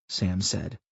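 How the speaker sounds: ordinary delivery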